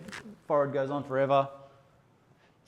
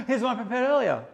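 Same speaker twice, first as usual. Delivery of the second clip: higher, laughing showman reveal; laughing presenter line; 9 semitones higher than usual. Acted. Presenter teases the photographers, laughing and higher. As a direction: chuckle through it like a TV host.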